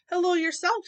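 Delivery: put-on high, loud old-lady greeting. Old neighbor greeting Mama, high chirp. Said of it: "said Miss Grizz".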